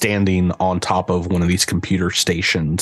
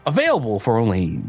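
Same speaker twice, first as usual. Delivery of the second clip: booming prize announcer; 5 semitones higher than usual. Acted. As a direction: sell it like a cheesy TV ad. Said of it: "a voice kind of pops out of the speaker here that says".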